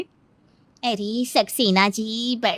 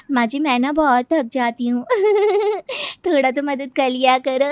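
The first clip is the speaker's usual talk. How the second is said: high giggle after each complaint; complain, then giggle it away; habitual laugh; 5 semitones higher than usual. Acted. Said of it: "ये वो वाली हंसी है". (शिकायत के बाद खिलखिलाहट)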